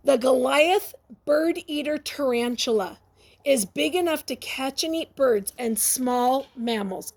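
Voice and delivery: usual speaking voice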